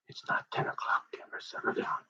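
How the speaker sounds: put-on whispered hushing of the kid; calm him down in a whisper. Whispered hush to Denver.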